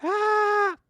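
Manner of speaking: high shrill mock scream; wife shrieking as the go-kart takes off, high mock scream; wife's scream. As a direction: imitate the wife's terrified squeal. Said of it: "I heard my wife go".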